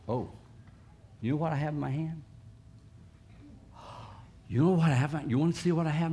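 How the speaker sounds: lightly sweet coaxing voice; coaxing a pet. Shown as a demonstration. Preacher coaxing a shy pet over, lightly sweet. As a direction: lure the shy animal with a teasing question. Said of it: "I'll go like this".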